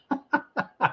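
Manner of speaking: high, quiet chuckle; laughing at Vivian's bragging; amused laugh. Real, not acted. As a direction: laugh at the viewer's brag.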